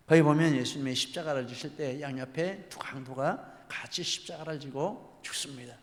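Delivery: normal talking voice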